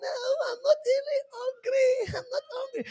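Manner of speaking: hammed-up simpering girl on a call. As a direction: squeal and giggle down the phone. Girl coyly refusing food.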